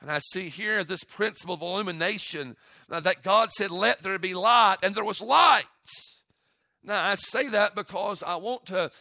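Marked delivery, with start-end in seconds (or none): 3.0-5.6 preaching declaration. Acted. Pastor proclaiming creation to the church, loud. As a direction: ring it out like scripture.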